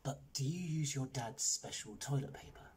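hushed, delicate question; soft, furtive enquiry; secretive whisper. A narrator's reading. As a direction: ask it very softly in confidence. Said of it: "whispered Raj".

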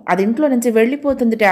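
plain reading voice

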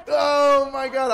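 loud, high, slow oh my God; drawn-out loud cry of disbelief; loud exclamation. Real, not acted. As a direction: shout your disbelief. Exclaiming at the clip.

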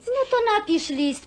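mother eagerly urging her daughter to write a letter; eager suggestion. Acted. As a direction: jump in with the idea. Mother eagerly proposing a solution.

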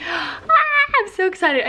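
excited squeal, pitched high; can't hold the excitement in. Real, not acted.

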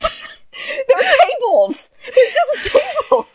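very high, drawn-out squeal; laughing so hard the words stretch; helpless laughter. Real, not acted. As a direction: wail it out while cracking up. Cracking up at table-like things.

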